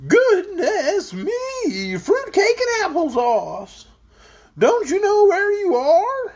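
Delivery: high, slow, wobbling astonishment; surprised bumpy voice. A narrator's reading. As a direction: exclaim in a drawn-out, bumpy high voice. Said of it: "looked at the speaker in surprise".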